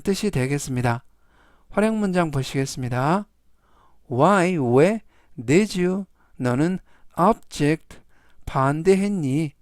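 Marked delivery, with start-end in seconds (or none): none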